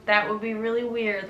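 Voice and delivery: normal talking voice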